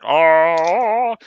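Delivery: mock grunge moan, mouth stuffed; muffled singer parody; potato-mouthed singer. Shown as a demonstration. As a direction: drone it round a potato in your mouth. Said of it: "stick a potato in your mouth".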